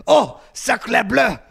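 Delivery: hammed-up French accent, high and loud, with a put-on lisp; overdone French outrage, slow and theatrical; over-the-top French accent with a comic lisp; Mark Lacroix French accent. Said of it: "going into two racist accents now".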